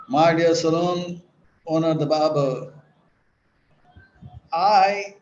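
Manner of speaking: booming, confident announcement to the barber; loud declaration. Retold or imitated. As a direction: declare it boldly for the whole shop. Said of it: "and in a very loud voice".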